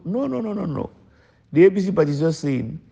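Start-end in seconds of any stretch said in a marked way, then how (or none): 0.1-0.9 high-pitched string of quick noes; high dismissive no-no-no; emphatic no. Real, not acted. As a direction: wave the idea away, pitched up high. Rejecting claim of asking favours.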